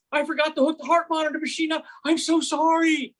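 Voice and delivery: high-pitched imitation of an apologetic nurse; retold gushing apology. Nurse owning up to a patient, mock apologetic. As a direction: pitch it up and stretch the sorry.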